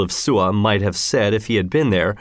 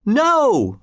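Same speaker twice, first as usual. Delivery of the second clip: high, drawn-out cry; narrator's mock scream; crowd scream; 12 semitones higher than usual. A narrator's reading. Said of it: "screamed everybody".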